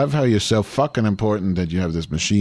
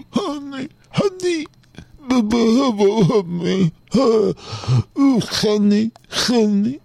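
squeaky high honeys, lost in laughter; laughing fit; 4 dB louder, much slower than usual. Real, not acted. Caller breaking up laughing on a voicemail, squealing honey. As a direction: try to say honey through helpless giggles.

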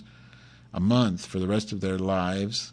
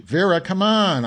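calling out; 10 semitones higher, 8 dB louder than usual. Real, not acted. Pleading with Vera for the song.

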